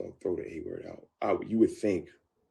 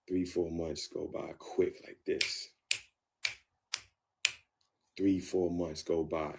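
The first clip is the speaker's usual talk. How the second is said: ordinary talk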